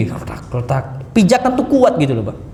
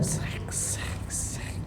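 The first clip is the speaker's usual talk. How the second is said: unvoiced scrape imitating dragged sandals; sandal scraping noise; 20 dB quieter, much slower than usual. Mimicking sandal-dragging sound. (bunyi sendal diseret, berdesis)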